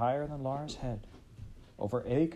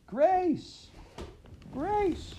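screaming. A narrator's reading. Laura screaming for her lost sister on the hill. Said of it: "she screamed".

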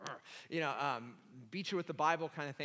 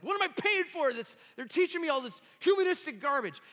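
much higher and louder, scandalized cry; outraged; 12 semitones higher, 9 dB louder than usual. Retold or imitated. Seminarian denouncing the book's false doctrine, loud outrage. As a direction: shriek it like an offended zealot. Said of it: "I was very upset".

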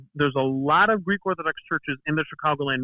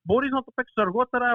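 higher-pitched rapid-fire mock telling-off; imitating scolding Greek mom; 8 semitones higher than usual. Mom catching son playing before homework, imitated scolding. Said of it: "Why are you guys yelling at each other?".

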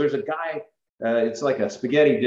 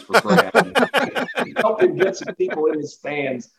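laughing through the words, slower and higher; 8 semitones higher, 3 dB louder, slower than usual. Real, not acted. Telling the host about buying pizzas for Brad, laughing. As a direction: chuckle out the punchline as you recall it.

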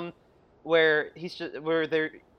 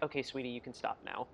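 gently dismissive; 6 semitones lower, 14 dB quieter than usual. Retold or imitated. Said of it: "she's just like".

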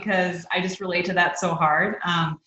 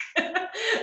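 bright high-pitched giggle; 13 semitones higher, 3 dB quieter than usual. Real, not acted. Laughs at her own story.

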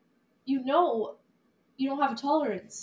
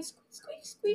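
1 squeak imitation; 13 semitones higher, 6 dB quieter, slower than usual. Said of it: "All the squeaking".

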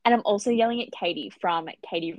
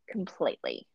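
ordinary delivery

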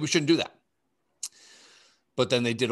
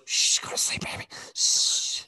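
hushed lullaby whisper; whispering over a crib. Retold or imitated. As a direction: soothe them to sleep in a whisper. Soothing a heroin user to sleep.

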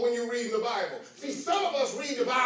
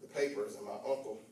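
low, hushed, quick anecdote; soft storytelling; 12 semitones lower, 7 dB quieter, faster than usual. Acted. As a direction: chat quietly like a memory.

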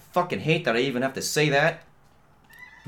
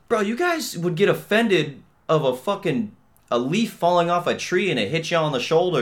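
raised, worked-up rant; heated rant; 7 semitones higher, 3 dB louder than usual. Retold or imitated. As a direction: go off on them. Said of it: "I'm like".

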